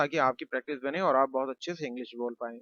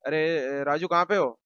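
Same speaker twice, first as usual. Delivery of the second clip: calling out; 2 semitones higher, 5 dB louder than usual. Acted. Someone at home calling out looking for Raju. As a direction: call out to find him. Calling name across house.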